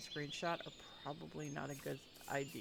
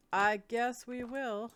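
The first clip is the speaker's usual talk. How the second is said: playful; 7 semitones higher, 8 dB louder, slower than usual. Real, not acted. Answers own question playfully.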